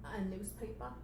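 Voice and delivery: quiet, meek query; soft puppet assistant voice; timid question. Acted. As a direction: ask it small and bewildered.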